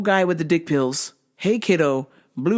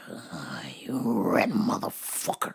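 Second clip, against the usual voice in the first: whispered insult; 11 semitones lower, 9 dB quieter, slower than usual. Retold or imitated. Worker retelling whispered insult about customer, slow whisper. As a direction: whisper it under your breath, slow.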